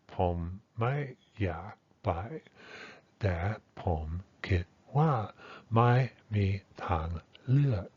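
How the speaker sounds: word-by-word Thai, each tone sounded separately; classroom Thai model. Shown as a demonstration. Teacher showing foreigners the textbook Thai tones, careful word-by-word model. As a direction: model each syllable's tone in isolation, with small gaps. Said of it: "as if it were being modeled by".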